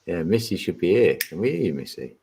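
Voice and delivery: normal talking voice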